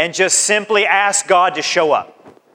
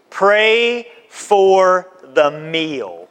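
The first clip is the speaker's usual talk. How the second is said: drawn-out command; much slower than usual. Acted. Preacher slows key point.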